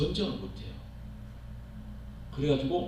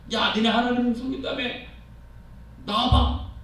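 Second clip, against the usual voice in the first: higher, sneering dare; mocking taunt; 7 semitones higher than usual. Goliath daring the Israelites, higher and sneering. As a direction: scoff at their God and challenge them.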